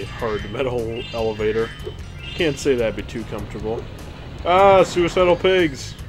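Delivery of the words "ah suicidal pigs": loud, slow delighted cry; excited. Real, not acted. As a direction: announce the pigs with glee. Pigs charging at him.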